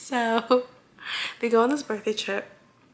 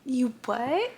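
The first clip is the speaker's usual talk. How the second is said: shocked disbelief; 4 semitones higher, much slower than usual. Retold or imitated. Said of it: "And Magnolia's like".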